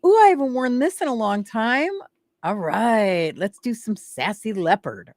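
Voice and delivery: high, loud excited self-talk; light up at a forgotten outfit; delighted discovery. Acted. Co-host to herself at her closet, excited. Acts out picking clothes.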